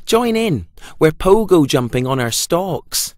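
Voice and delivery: lightly voiced lively sprouts; sprouts' voice. A narrator's reading. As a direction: bounce it like jumping sprouts. Sprouts invite Pumpkin.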